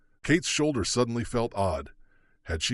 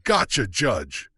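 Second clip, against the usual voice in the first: slow, louder reply; shouted; 5 dB louder, slower than usual. A narrator's reading. Marshal answers the judge, slow shout. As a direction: call back firmly and slowly. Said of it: "Tony shouted".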